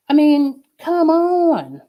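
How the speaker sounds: loud and high, fed up; exasperated outburst. Real, not acted. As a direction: throw up your hands: come on!.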